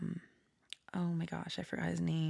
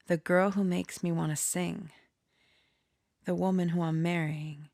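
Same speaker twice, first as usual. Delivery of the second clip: normal talking voice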